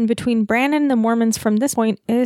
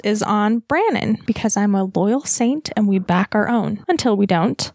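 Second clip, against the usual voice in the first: usual speaking voice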